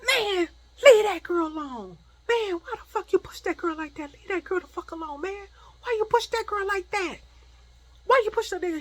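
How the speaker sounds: high, loud, indignant telling-off; angry confrontation. Retold or imitated. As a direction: act out an outraged cousin stepping in. Acted cousin confronting the pusher.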